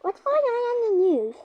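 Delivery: normal talking voice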